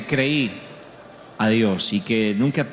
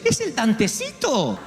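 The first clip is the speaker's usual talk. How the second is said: put-on old mother's voice; 9 semitones higher, slower than usual. Mother recognizes son.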